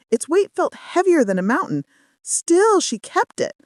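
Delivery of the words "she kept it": ordinary delivery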